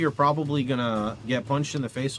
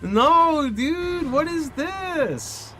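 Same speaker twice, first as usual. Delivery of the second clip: much higher, slow, drawn-out complaint; exasperated disbelief; 13 semitones higher, 4 dB louder, much slower than usual. Real, not acted. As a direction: stretch it out, fed up. Another bad drop.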